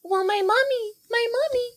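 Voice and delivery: put-on very high childish pleading; little girl voice. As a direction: sound like a tiny scared girl. [name] explains her pigtails.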